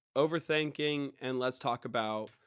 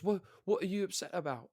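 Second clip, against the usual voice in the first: higher, put-on voice of the English guy; imitating opponent; 7 semitones higher than usual. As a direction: mimic his innocent question in a higher voice. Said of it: "And he goes".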